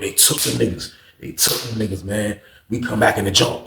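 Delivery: ordinary delivery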